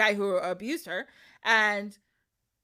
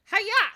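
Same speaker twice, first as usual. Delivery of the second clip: high karate-style yell; hammed-up kick shout; mock battle cry; 10 semitones higher, 3 dB louder than usual. Princess kicks the scroll.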